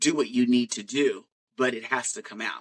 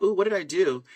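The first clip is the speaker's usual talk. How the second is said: higher-pitched, mock-startled question; puzzled alarm; 8 semitones higher, 3 dB louder than usual. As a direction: replay waking up baffled by what you did. Retold waking to injured knuckles.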